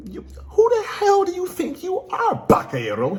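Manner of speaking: hammed-up high-pitched screech; mock outrage shooting into falsetto; annoyed villain. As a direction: screech it like an indignant anime villain. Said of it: "Kaido's annoyed".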